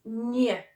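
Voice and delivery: "ñ" sounded as a drawn-out "nyuh"; "ñ", like the ny in onion; Spanish ñ. Shown as a demonstration. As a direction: model "ñ" as "nyuh". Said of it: "N with a squiggle on it".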